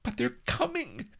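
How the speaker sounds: very high frightened cry; cried in alarm. A narrator's reading. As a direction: gasp out the warning high. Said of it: "cried Vanity".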